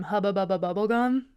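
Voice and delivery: savoring each bouncy syllable; playful repetition. Shown as a demonstration. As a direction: relish the sounds of the words. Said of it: "I'm gonna say it one more time".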